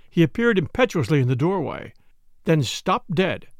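ordinary delivery, nothing marked

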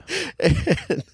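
high burst of real laughter. Real, not acted. Laughing at the bee scene he recalls, real laugh. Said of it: "it makes me laugh right now".

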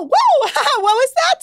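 high, loud startled whoa with a laugh; real laughing surprise; real startled outburst. Real, not acted. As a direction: jump at your own noise. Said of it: "I apologize for that freak out".

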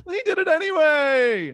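high, loud sitcom-comedian imitation; Seinfeld. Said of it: "I sounded like Seinfeld. I was like".

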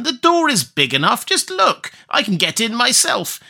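very high, shrill witch squeak; impatient witch. Acted. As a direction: scoff at the silly girl, squeaking up high. Witch shows Gretel the oven.

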